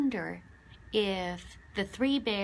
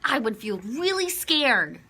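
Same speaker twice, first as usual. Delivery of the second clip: ordinary delivery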